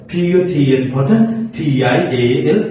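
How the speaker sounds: usual speaking voice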